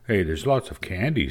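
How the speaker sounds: usual speaking voice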